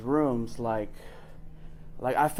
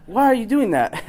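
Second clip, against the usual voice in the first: raised and loud, pointed; challenging question; 6 semitones higher, 7 dB louder than usual. Retold or imitated. Mentor questioning why he's dating again, pointed. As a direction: call him out on it.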